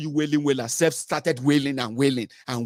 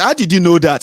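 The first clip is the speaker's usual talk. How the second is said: mock amazed shout; 4 semitones higher, 11 dB louder than usual. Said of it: "I said".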